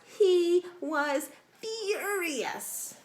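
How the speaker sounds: loud, slow, high furious; drawn-out dramatic fury; mock furious. A narrator's reading. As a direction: boom out the anger playfully. Dramatic fury read slowly.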